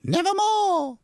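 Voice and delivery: high, loud raven screech; raven shriek. Acted. Bird replying to the narrator, loud raven screech. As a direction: cry it out like a bird. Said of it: "Then the bird said".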